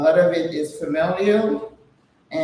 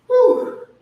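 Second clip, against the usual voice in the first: breathy whew after a workout; relieved exhale; 6 dB louder than usual. Real, not acted. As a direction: blow out a loud relieved whew.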